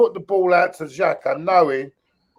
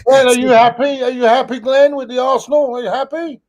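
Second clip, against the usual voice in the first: louder, higher teasing jab at Glenn; teasing taunt; 7 semitones higher, 4 dB louder than usual. Real, not acted. Taunting the upset fan Glenn, loud and teasing. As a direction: needle him, sing-song and loud.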